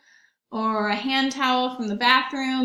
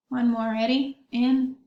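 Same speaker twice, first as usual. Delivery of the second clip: usual speaking voice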